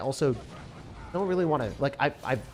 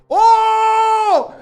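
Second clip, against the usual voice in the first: high, loud burst of joy; excited shout; 15 dB louder than usual. Real, not acted. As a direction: blurt it out thrilled. Delighted at good deck.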